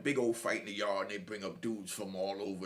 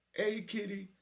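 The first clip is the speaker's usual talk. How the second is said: higher, mock feminine call; attempted female voice; 9 semitones higher, slower than usual. Inmate calling to kitty in a put-on female voice. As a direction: poorly imitate a woman calling out. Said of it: "it's a female voice".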